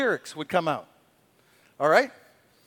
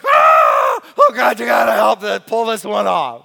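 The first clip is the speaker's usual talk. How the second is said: loud pleading shout; desperate shout; 11 dB louder than usual. Retold or imitated. David begging God to pull him through, shouting. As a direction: beg God for rescue. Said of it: "he's like".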